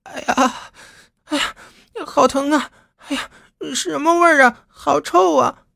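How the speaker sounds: put-on very high girl's whimpering cry of pain, then disgust. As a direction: wail over the bump, then the smell. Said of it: "捂着头就叫道".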